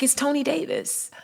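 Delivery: soft, slow, breathy "It's Tony Davis"; imitating his hushed answer; whispered reply. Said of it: "he was like".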